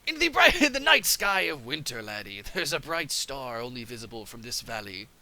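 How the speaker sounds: put-on gruff old sea captain; Mister Krabs voice. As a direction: growl it like a crusty crab skipper. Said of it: "I gotta make him sound like Mister Krabs".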